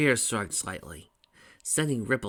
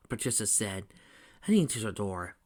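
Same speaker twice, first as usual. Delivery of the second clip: ordinary delivery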